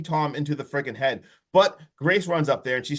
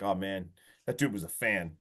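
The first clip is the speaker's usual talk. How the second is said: low, soft mock mourning; wicked sad; 8 semitones lower, 8 dB quieter than usual. Tom mourning the dead pizza guy, low and soft. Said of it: "Tom's wicked sad".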